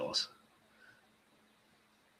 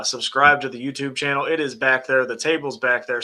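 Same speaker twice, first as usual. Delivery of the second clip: ordinary delivery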